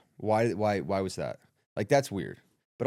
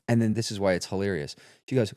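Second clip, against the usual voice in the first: ordinary talk, nothing marked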